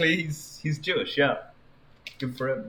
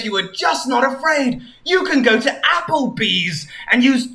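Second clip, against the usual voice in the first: lightly voiced eager hero, pitched up; a touch of rousing cartoon pep talk; enthusiastic Sonic; 7 semitones higher, 3 dB louder than usual. Sonic lists what she could do, lightly voiced enthusiasm.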